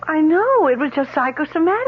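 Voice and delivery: cling to belief; high, overwrought protest; distressed insistence. Acted.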